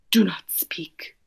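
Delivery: hushed dramatic whisper. Acted. Gerald silencing Piggy, in a tragic whisper. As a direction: hush her, barely breathing.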